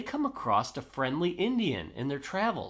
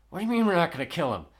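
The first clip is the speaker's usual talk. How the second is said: fast, higher-pitched retold objection; incredulous protest; 5 semitones higher, 4 dB louder, much faster than usual. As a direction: fire back in acted disbelief. Acted protest against sparing attacker.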